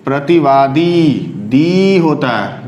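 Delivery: प्रतिवादी slow and low, दी drawn long; long dee. Shown as a demonstration. Teacher models the long vowel for students, drawn out. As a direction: stretch the final दी of प्रतिवादी. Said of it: "लंबा sound आता है".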